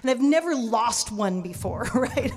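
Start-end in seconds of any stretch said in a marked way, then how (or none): none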